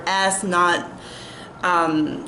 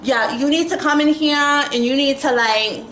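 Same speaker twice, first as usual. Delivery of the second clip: a hammed-up scolding, pitched up; bossy mock quote; 6 semitones higher than usual. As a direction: mimic her telling you off. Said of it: "she's talking just like this".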